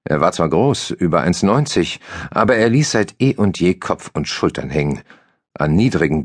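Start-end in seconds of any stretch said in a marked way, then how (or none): none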